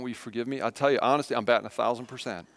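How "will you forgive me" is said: gently asking forgiveness, much softer; a soft, humble question to the kids; soft humble plea. Shown as a demonstration. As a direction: ask your child quietly for pardon. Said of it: "I say those words".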